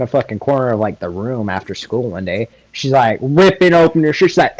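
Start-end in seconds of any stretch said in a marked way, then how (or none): none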